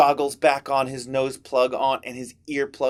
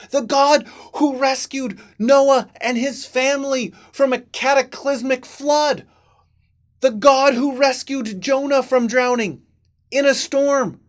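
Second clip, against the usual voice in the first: rising, louder fervent preaching; 7 semitones higher, 4 dB louder than usual. Acted. Ed reminding himself of God's power, rising fervent preaching. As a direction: proclaim it with mounting fervor.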